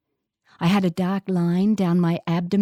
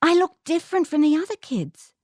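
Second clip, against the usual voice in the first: put-on insisting child; 9 semitones higher than usual. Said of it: "I insisted".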